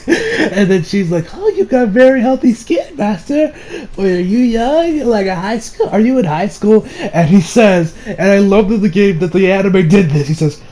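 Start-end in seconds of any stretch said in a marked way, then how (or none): 1.3-6.9 high-pitched mock maid voice; fawning maid. Maid asking her young client's age, high fawning voice. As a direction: coo it high, imitating the maid.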